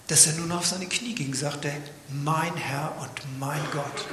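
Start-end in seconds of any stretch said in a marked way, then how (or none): 2.1-4.1 awed surrender. Acted. Thomas kneels in awe.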